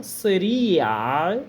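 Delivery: Sari'a with a short first vowel and a long final i, rising end; Arabic word Sari'a. Shown as a demonstration. Teacher drilling Sari'a for students, long final vowel. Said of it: "Vocês perceberam a vogal i no final?".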